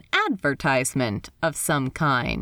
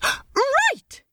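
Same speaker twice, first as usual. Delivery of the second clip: excited exclamation. Acted. Steed excited realization.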